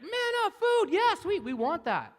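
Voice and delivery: very high, loud squeal of delight; greedy Israelites. Retold or imitated. Preacher mocking the Israelites craving manna, squeaky and loud. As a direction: squeal like a greedy kid at the snack table. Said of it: "kinda like the Israelites".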